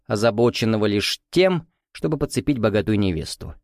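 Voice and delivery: ordinary delivery, nothing marked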